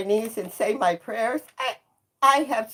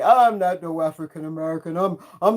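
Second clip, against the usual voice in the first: mock denial; 3 semitones lower, faster than usual. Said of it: "Some of us who look like me".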